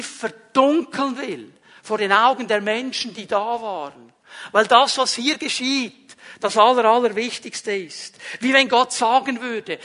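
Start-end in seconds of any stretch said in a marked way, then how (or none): none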